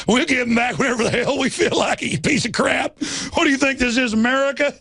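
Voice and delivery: high sneering cop parody; mock the cop, pitched well up; high mocking tyrant cop. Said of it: "you notice the cop's like".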